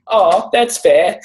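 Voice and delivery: hammed-up casual student reply. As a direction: drag it out like an unbothered kid. Students' reply in teacher story.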